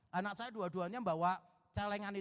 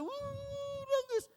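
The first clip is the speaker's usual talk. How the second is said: mock wailing; much slower than usual. Child cries harder.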